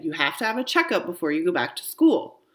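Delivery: ordinary delivery, nothing marked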